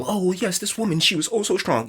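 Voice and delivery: high, quick mocking gush. Host mocks anecdotes of strong women, high sarcastic gush. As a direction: sneer at the praise by gushing it fast.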